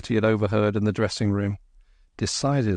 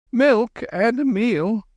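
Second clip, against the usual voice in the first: high, slow nervous stammer; 13 semitones higher, 4 dB louder, slower than usual. A narrator's reading. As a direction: stammer it out, flustered. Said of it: "the man stammered".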